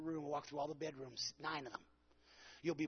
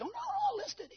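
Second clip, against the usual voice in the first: a much higher, squeaky eager agent; eager realtor; 9 dB louder, much faster than usual. Retold or imitated. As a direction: play the flustered agent backpedalling. Agent's eager reversal voiced.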